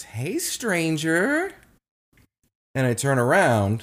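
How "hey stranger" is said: high, drawn-out singsong greeting; imitating ex's greeting. Imitating the ex surprising him from behind, singsong greeting. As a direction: stretch it out high, rising at the end. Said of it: "from behind me, I just hear this".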